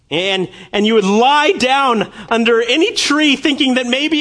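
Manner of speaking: high, loud preaching at full force; impassioned preaching. Acted. Preacher pleading with the congregation, loud and fervent. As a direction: pour it out over the congregation, fervent.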